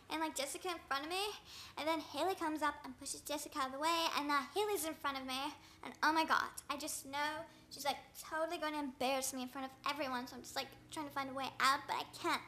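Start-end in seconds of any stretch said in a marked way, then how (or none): none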